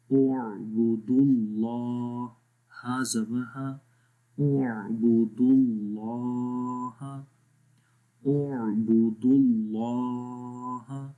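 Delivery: slow اعبدوا اللہ with اللہ read full and heavy; heavy Allah. Shown as a demonstration. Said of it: "اللہ کا نام موٹا پڑھتے ہیں". (اعبدوا اللہ آہستہ، اللہ کا نام موٹا)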